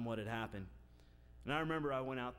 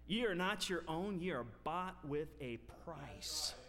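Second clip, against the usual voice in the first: full-force preaching; 4 semitones higher than usual. Acted. Preacher driving home holiness to his congregation, full force. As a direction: proclaim it to the whole church.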